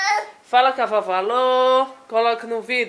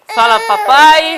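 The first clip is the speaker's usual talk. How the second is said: a loud, raised call with a cry in it; 5 semitones higher, 12 dB louder than usual. Real, not acted. Mother calling loudly to her baby to say papai.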